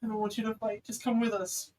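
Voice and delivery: worried mom making an emotional appeal; distraught mother pleading. Acted. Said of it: "you see your mother, her hair all undone and crazy".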